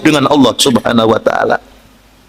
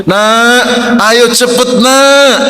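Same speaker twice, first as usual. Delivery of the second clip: calling out; 4 semitones higher, much slower than usual. Retold or imitated. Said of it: "Lalu ibu menyeru".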